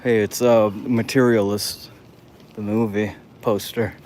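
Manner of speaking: ordinary talk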